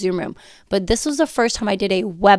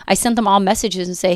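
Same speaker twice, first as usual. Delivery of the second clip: normal talking voice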